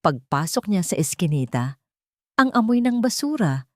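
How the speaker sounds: plain reading voice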